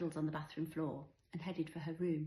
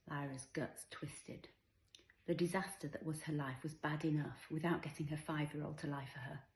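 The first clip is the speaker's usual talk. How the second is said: usual speaking voice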